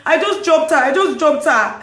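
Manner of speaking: high, loud imitated man's voice; imitated protest. Man in the car explaining himself, high loud imitation. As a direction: raise the voice in a repeated mock protest.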